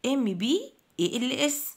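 letters in groups with short pauses; spelled in chunks. Shown as a demonstration. Teacher divides cymbals for pupils to memorise, chunked spelling. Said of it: "أنا مقسمة الكلمة".

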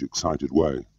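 ordinary delivery, nothing marked

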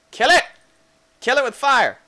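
high, loud outburst; mock panic shout. Player attacking a strange creature, mock panic shout. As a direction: yell it in playful alarm.

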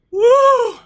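high loud whoop from the burn; pained whoop. Real, not acted. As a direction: let out a sharp hot-pepper woo. Reacting to burning spicy chip.

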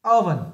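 Oven said loud and clear; teacher models Oven, first syllable strong; model word. Shown as a demonstration. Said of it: "Các bạn để ý này".

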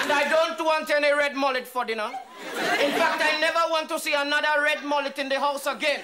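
high, loud, indignant orders; domineering husband. Acted. Husband ordering his wife about dinner, loud and indignant. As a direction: lay down the law, pitched well up.

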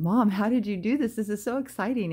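loud, high retold excitement; excited. Daughter exclaiming to her mom about first class tickets, excited. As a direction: relive the thrill of the surprise. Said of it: "I was just really excited".

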